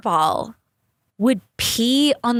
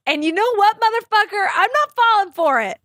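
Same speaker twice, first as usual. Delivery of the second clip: higher, mocking challenge; defiant taunt; 10 semitones higher than usual. Real, not acted. Talking straight to the peeing cat, defiant and mocking. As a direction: call the cat's bluff, pitched way up.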